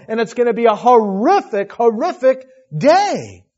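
loud, urgent sermon build; impassioned preaching. Real, not acted. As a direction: drive the warning home with full force. Preacher warns of hell.

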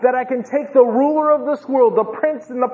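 loud, high proclamation with stretched key words; impassioned preaching. Acted. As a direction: drive it home with full pulpit force. Preaches at full force as Jesus.

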